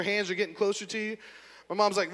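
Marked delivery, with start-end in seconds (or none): none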